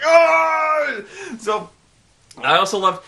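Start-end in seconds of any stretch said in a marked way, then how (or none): 0.0-1.0 high, loud exclamation; mock colonel shout. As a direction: yell it like a gung-ho Marine. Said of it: "he's like".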